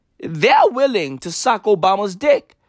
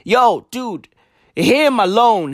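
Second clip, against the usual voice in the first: ordinary talk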